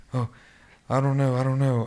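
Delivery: mimicking a frightened wife, a touch of panic; imitating scared wife. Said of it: "she started getting scared".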